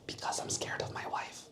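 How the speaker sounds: soft whisper, almost breath only; whispered confession. Acted. Crocodile admits his fear of his wife, whispering. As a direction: confide it in a hushed whisper.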